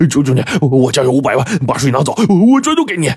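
high pleading, rising into a frantic wail; desperate begging. A narrator's reading. 发病的肥仔向医生苦苦哀求. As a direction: beg for your life, offering everything. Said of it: "苦苦地哀求说".

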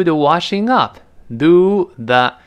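the washing up drawn out slowly; 慢速示范 the washing up; slow model reading. Shown as a demonstration. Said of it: "另外一个表达叫". (放慢示范 do the washing up)

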